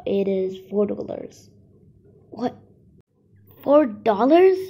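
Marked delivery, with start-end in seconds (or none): 2.3-4.6 loud shocked disbelief at the price. Acted. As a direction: blurt it out in shock.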